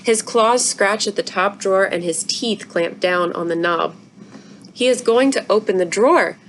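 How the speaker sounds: ordinary delivery, nothing marked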